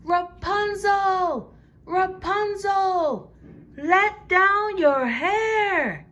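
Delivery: high, slow, loud sing-song call; prince calling up. Acted. Prince calling to Rapunzel at the tower, high and slow. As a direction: stretch every word up to the window. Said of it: "every time he would call out".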